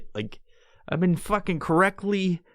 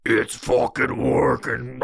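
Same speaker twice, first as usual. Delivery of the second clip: ominous slow voice; 4 semitones lower, slower than usual. Retold or imitated. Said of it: "it was like".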